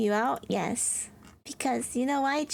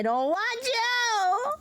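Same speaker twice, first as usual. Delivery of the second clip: mock wail; 10 semitones higher, much slower than usual. Playful fake crying at a friend.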